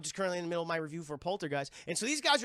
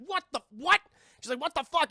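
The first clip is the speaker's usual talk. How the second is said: much higher, louder startled cry; shocked outburst; 9 semitones higher, 8 dB louder than usual. Retold or imitated. Mother finding the chairs stacked, high shocked cry. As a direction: blurt it out in shock.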